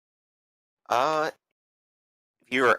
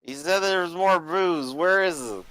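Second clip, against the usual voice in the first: put-on higher, slurring rowdy drunk; 6 semitones higher than usual. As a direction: play a belligerent tavern drunk.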